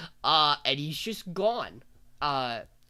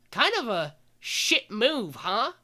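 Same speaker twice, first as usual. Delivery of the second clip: slow, high-pitched pointed jab; drawn out, imitating a pointed complaint; mock reproach; 7 semitones higher, 3 dB louder, slower than usual. [name] chides the witches for exiling Chloe, mock reproach.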